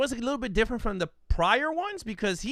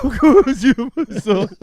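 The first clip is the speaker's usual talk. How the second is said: can't get the line out without cracking up; laughing impression; 3 semitones higher, 11 dB louder than usual. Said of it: "He's like".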